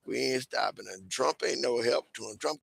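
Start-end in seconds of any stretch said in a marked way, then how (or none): none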